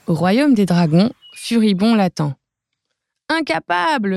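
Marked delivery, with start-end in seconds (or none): 3.3-4.2 high, scornful cry of the king; mock roar. Dragon king scolds Baluchon, high and scornful. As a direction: spit out the insult in scorn. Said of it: "rugit le roi".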